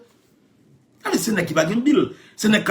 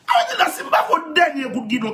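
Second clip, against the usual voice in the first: laughing; 8 semitones higher than usual. Real, not acted. Repeats the joke, laughing.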